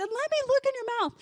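high-pitched pushy repeat request; insistent friend. Retold or imitated. Aunt's friend pressing mom to open up, insistent. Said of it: "And she said".

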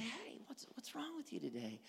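soft, casual check-in; gentle voice inflection. Shown as a demonstration. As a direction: ask it softly and kindly. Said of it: "you can look at things like voice inflection".

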